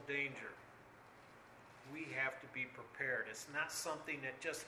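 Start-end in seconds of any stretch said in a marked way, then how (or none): 1.9-3.3 softer, low and earnest; a quiet, sober warning. Real, not acted. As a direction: say it almost to yourself. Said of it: "You've got to be prepared".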